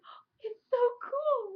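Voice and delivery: high, loud squeal of delight. Retold or imitated. Retelling a friend's gift, squealing in delight. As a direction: gush like the gift is the best thing ever. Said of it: "And I was like".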